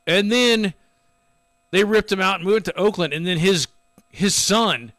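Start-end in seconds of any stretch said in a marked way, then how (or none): none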